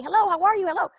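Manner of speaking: bubbly, overeager hello; eager greeting. Retold or imitated. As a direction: gush at each new person at the party. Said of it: "I was just so excited to meet everybody".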